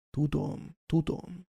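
soft sighing tudom, tudom; sighed. A narrator's reading. Headmaster comforting the grieving professor, soft sigh. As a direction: breathe it out in a weary sigh. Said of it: "sóhajtott".

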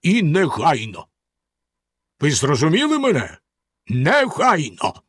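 stern. A narrator's reading. Bluebeard orders his wife's death at once, sternly. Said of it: "суворо сказав він".

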